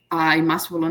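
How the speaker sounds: ordinary talk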